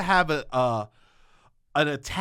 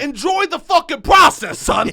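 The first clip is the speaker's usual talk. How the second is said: laughing mock yell from a New York dad, higher and louder; 10 semitones higher, 7 dB louder than usual. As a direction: bark it at the son like an outraged father. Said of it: "he goes".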